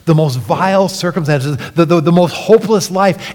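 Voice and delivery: ordinary delivery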